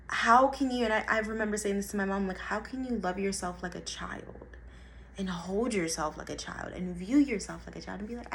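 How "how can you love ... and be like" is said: usual speaking voice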